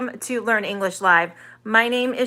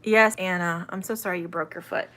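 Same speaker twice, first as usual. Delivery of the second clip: normal talking voice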